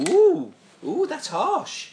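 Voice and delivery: high, loud ooh of shock; surprised. Real, not acted. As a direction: gasp at the harsh score. Reacting to a low score.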